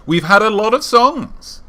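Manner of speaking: slow, high and loud exclamation. Real, not acted. Host repeats the boast about songs, in a slow high exclamation. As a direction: stretch it out, climbing high on songs.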